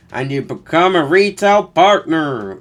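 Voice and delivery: cheesy American pitchman; mock American accent. Reading ad in American voice.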